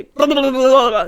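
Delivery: loud mock babble of anxious vampires; anxious vampire gibberish. Imitating anxious vampires talking among themselves, loud gibberish. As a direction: babble nonsense loudly, imitating anxious chatter. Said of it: "They talk like that sometimes when they're anxious".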